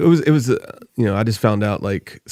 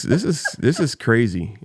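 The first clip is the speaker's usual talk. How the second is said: normal talking voice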